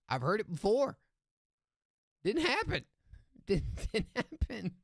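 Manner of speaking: high, loud teasing doubt; mock disbelief. Real, not acted. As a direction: scoff that it never happened.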